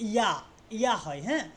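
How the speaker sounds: normal talking voice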